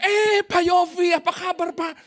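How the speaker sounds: high, drawn-out effusive hello; gushing mock greeting. Woman greets the pastor at church, hammed-up gushing. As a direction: stretch the "Eh" high and loud, imitating her.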